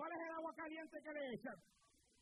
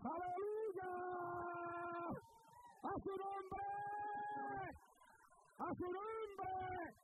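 exultant praise shout; 7 semitones higher, much slower than usual. Real, not acted. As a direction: lift the congregation in praise. (grito de alabanza, agudo y fuerte)

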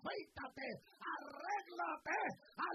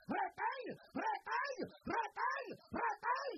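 repeated word climbing higher and louder to a slow shout; rising shout; 9 semitones higher, 10 dB louder, much slower than usual. Real, not acted. Preacher urging the church to hold on, building shout. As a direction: build each retén to a roar.

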